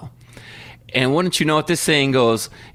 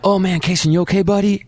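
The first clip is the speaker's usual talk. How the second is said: concerned dad; 6 semitones higher than usual. Retold or imitated. Dad running to hurt son.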